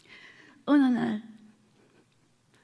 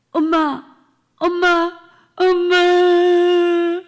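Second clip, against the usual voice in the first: higher, louder call, last word long drawn out; desperate calling out; 6 semitones higher, 9 dB louder, much slower than usual. Retold or imitated. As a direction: cry out for her through the house. Child calling for missing mother.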